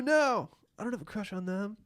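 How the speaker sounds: ordinary delivery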